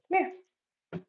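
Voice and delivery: ordinary talk, nothing marked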